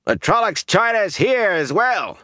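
high-pitched acted shout. A narrator's reading. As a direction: shout it out over the other men. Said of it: "Hari Copland shouted".

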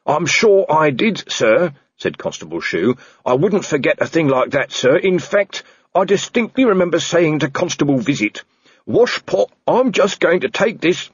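dutiful junior reporting to his boss; earnest constable. Acted. Constable Shu voiced in story.

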